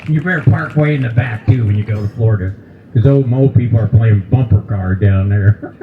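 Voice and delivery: ordinary talk, nothing marked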